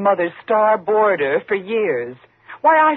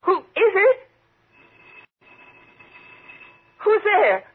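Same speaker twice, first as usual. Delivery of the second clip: frightened calling out; 11 semitones higher than usual. Acted. Alone at night, she calls out to an intruder in fear. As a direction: call out toward the door, scared stiff. Someone enters her locked penthouse.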